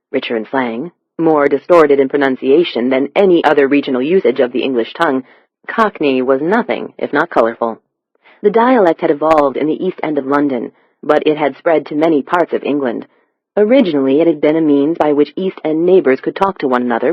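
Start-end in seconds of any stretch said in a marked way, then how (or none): none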